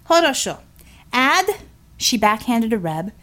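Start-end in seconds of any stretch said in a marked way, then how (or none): 0.1-1.6 put-on Russian-accented, clipped and high; a hint of a Russian commander counting. Russian heroine's voice.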